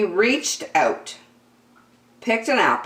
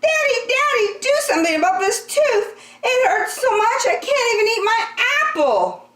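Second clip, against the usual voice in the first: put-on high, fast wailing child's cry; yelling child; faster than usual. A narrator's reading. Andrew wailing to his dad about the tooth. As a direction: wail for Daddy like a hurting kid. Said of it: "and yelled".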